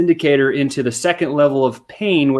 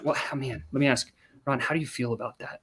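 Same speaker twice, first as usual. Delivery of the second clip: much softer, hushed and gentle; soft probing question; 11 dB quieter than usual. Shown as a demonstration. Salesman softly asks the client how he feels.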